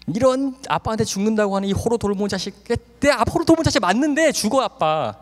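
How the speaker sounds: cursing father. Retold or imitated. Father cursing the son who warned him. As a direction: spit out the insult.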